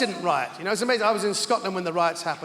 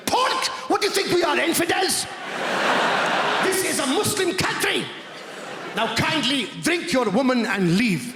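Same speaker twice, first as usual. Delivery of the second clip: mock outrage; 6 semitones higher than usual. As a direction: play the offended guide, scandalised. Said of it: "He went".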